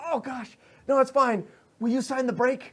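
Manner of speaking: high, loud flustered fanboy; hurt but starstruck. Acted. Injured guard asking idol to sign the break, starstruck. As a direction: brush off the pain, pleading eagerly. Hurt fan still wants autograph.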